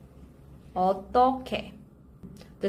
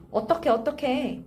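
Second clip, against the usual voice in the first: quick, repeated mock fretting; acted helpless exclamation; 3 semitones higher, much faster than usual. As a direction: fret lightly in a rapid repeat. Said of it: "So when you say".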